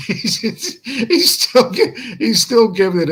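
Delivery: amused at the troll who won't stop; laughing. Real, not acted. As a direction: laugh while trying to get the words out. Laughing as the troll keeps going.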